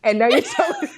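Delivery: high voice collapsing into laughter; cracking up. Real, not acted. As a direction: lose the sentence to a laughing fit. Mock confrontation, cracks up laughing.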